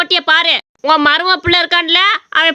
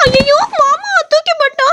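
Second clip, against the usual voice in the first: much higher, shrill alarmed wail; young wife wailing as she is thrown; startled cry; 11 semitones higher than usual. Acted. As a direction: yelp as you are dropped. Godavari thrown down, cries out.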